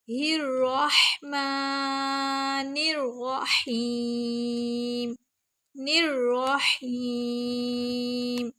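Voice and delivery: ہی الرحمن الرحیم drawn very slowly; slow careful recitation. Shown as a demonstration. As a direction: breathe out the ha, stretch الرحیم. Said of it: "تیزی سے سانس کو باہر نکال کے". (آہستہ صاف تلاوت)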